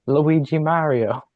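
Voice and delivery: slow, high Luigi impression. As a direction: answer as Luigi, drawn out. Guesses the quote playfully.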